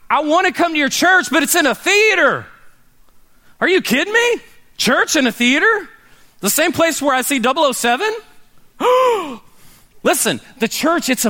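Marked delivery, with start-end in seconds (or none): 0.1-8.1 high mock disbelief, hammed up; incredulous invitee. Friend to pastor, hammed-up high incredulous scoff. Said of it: "you invite people all the time". 8.8-9.4 high hammed-up shocked gasp; mock gasp. Scandalized friend, high theatrical gasp.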